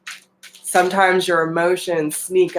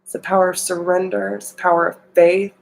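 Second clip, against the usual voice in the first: ordinary delivery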